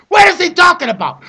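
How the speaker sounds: exasperated yelled repeat; shouted question. Retold or imitated.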